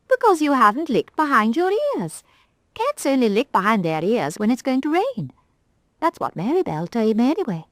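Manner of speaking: a proud little doll, miffed; Galdora the rag doll. Acted. Rag doll sniffing at the conceited cat.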